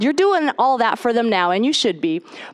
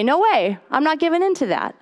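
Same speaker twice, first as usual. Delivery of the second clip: firm, raised-pitch push-back; defiant refusal; 6 semitones higher than usual. Acted. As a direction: stamp it out with resolve. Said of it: "stomp and say".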